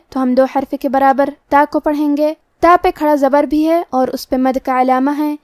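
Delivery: normal talking voice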